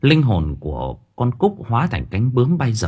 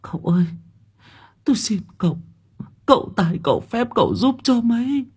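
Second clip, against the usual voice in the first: much higher, tearful imploring; begging mother; 13 semitones higher, faster than usual. A narrator's reading. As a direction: plead on your knees. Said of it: "Bà Thu quay sang lạy cậu".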